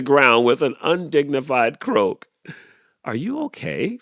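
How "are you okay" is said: high worried fuss. A narrator's reading. Goose fussing over fallen Clyde, high worry. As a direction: fuss over him, higher. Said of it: "fussed Roberta".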